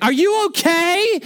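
high, slow, mock-worried woman's voice; startled woman rushing over to a fallen child, high and slow; fussing stranger. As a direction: coo it like an anxious stranger. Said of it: "she's like".

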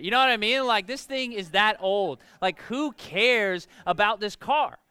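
loud, high angry rant, slowing at the end; flipped out. Retold or imitated. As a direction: rail at dad about the old Honda. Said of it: "I flipped out".

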